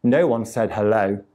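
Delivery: plain reading voice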